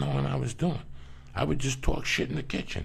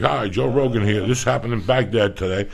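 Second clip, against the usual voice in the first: mock news anchor, louder; imitating a news anchor, louder; Joe Rogan newsreader; 7 dB louder than usual. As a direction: read it like a TV anchor, half-joking. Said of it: "I thought a news guy".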